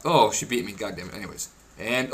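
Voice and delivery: ordinary talk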